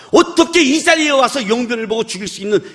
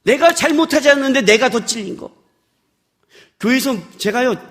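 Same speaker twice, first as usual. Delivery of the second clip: ordinary talk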